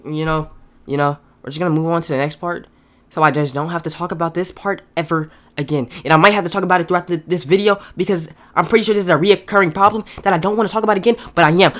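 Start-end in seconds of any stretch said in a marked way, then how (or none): 0.1-5.9 suddenly soft and low; restrained exasperation. Real, not acted. Reviewer cutting off his own rant to move on, restrained and quiet. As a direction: drop the voice, reining yourself in.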